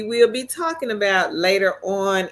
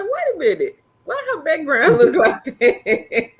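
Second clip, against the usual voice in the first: high, laughing disbelief at the background; acting out her own baffled reaction with a laugh; mock surprise; 8 semitones higher, 4 dB louder than usual. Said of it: "'cause I was like".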